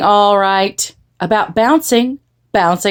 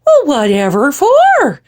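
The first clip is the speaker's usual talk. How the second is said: higher, louder motherly question; baffled mama; 6 semitones higher, 5 dB louder than usual. A narrator's reading. Mama questioning the girls about the tutu, baffled. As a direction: ask it in exasperated bafflement. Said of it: "asked Mama".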